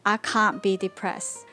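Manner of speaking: usual speaking voice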